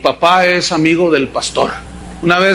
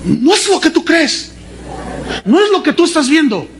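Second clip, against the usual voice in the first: high, indignant wife voice; mocking outraged wife, pitched high; wife catching cheater; 7 semitones higher than usual. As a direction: play the betrayed wife, high and incredulous. Preacher acts wife catching husband.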